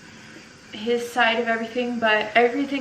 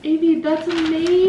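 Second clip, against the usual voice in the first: excited praise; 6 semitones higher, 6 dB louder than usual. Real, not acted. Mom praising her child's drawing, excited.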